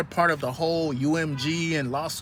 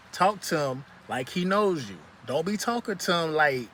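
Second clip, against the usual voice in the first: ordinary talk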